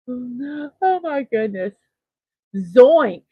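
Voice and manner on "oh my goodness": usual speaking voice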